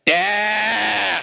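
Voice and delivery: long, high, loud imitated yell; toddler scream. Father mimics son screaming to get up, drawn-out toddler scream. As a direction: scream it like a toddler demanding a parent, held out. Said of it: "he starts screaming".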